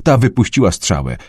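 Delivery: plain reading voice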